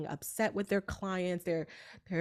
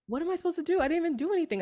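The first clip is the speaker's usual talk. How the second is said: retold panic; 10 semitones higher, much faster than usual. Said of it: "And I'm like".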